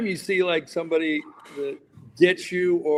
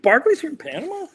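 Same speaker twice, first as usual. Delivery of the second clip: much higher, astonished rise; amazed question; 11 semitones higher, 4 dB louder than usual. Retold or imitated. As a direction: blurt it out in disbelief. Hearing Barkley is in Panama.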